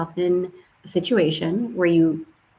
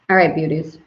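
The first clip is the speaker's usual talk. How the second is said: ordinary talk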